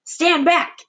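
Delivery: high, urgent shout; Aunt Sponge shouting a warning. Acted. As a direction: order them back sharply. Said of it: "Aunt Sponge shouted".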